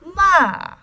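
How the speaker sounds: loud MA falling straight from high; fourth tone. Shown as a demonstration. Teacher going through the chart, falling MA. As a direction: plunge MA from top to bottom. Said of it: "straight fall from the top".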